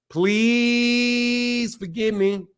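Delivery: PLEASE held very long; hammed-up drawn-out plea on PLEASE; mock begging. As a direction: stretch PLEASE out in one long, level note. Exaggerated begging after missing a message.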